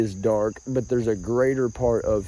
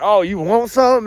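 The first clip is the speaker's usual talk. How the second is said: louder and higher, a hammed-up "you want something"; mock challenge; 9 semitones higher, 7 dB louder than usual. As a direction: play the guy picking a fight. Retold snap at provoker.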